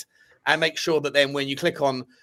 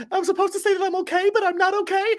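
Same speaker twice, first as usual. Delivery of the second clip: much higher mock pop-star wail, a bit faster; faster than usual. Teasing his mate by mimicking the meme in a much higher mock wail. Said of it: "She was like".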